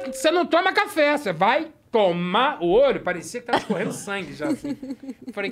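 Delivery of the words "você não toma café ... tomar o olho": mock mom threat.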